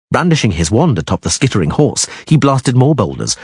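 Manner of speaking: ordinary delivery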